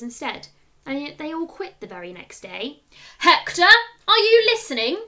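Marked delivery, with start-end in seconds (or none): 3.2-5.0 loud, high angry shout of a teacher; furious teacher yelling Hector's name. A narrator's reading. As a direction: bellow at the daydreaming boy. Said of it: "Mister Lancaster's angry voice".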